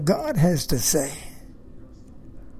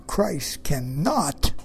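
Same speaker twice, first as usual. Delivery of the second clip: slow, weighted "cannot", leaning on its NOT; stress on NOT; 3 semitones lower, much slower than usual. Real, not acted. Preacher insisting on what Christ can't do, stressing "not" in "cannot". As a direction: draw out "cannot" to land hard on the "not". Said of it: "I emphasize the word not".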